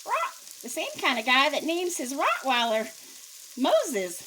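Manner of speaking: loud, high parrot squawk. Acted. Parrot answering the burglar, loud squawk. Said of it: "retold parrot punchline".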